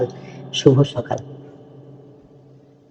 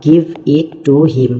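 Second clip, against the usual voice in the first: very slow model reading; much slower than usual. Shown as a demonstration. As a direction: try it out, each word drawn out very slowly. Teacher models English phrase slowly. (খুব ধীরে ধীরে স্পষ্ট উচ্চারণ)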